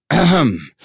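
loud, formal throat-clearing. A narrator's reading. Moomin papa prepares to give a toast, loud throat-clearing. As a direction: clear the throat to open a speech. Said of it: "schraapte zijn keel voor een speech".

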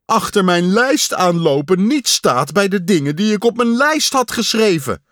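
high, loud plaintive wail; high loud lament about the list; whining. A narrator's reading. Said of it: "jammerde Pat".

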